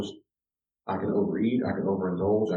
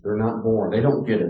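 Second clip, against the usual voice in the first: ordinary talk, nothing marked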